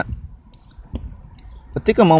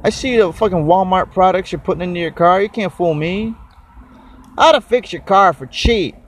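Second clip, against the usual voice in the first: higher-pitched, hammed-up angry comeback; mock angry retort; 8 semitones higher than usual. Ranting back at the YouTube guy, mock angry. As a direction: tell him off in a mock rage. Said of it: "I'm like".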